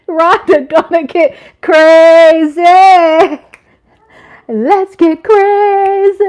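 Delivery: high, loud, stretched-out "crazy"; wild hype. Real, not acted. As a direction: rev the chat up for a party. Hyping up dancing to music.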